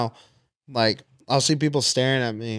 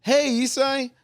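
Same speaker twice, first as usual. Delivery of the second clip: high, bright fake-friendly greeting; acted fan; 11 semitones higher, slower than usual. Acting scene for Isai, high fake-friendly fan greeting. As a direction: pitch way up like a fake-nice fan. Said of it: "Acting scene, ready?".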